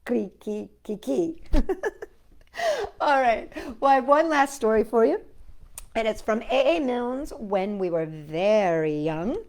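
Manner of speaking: ordinary delivery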